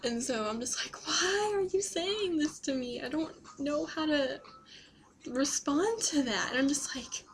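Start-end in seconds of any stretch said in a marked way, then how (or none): 1.1-6.5 higher, half-whispered disbelief; imitating her stunned self, trailing into a whisper; mock stunned reply. Retelling what she told the stranger, in mock stunned disbelief.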